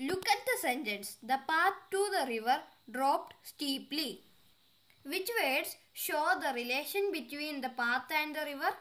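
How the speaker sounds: slow, deliberate read-aloud of an English exercise; careful dictation pace; slow textbook reading. Acted. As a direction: read each word out clearly for the class. Reads exercise slowly.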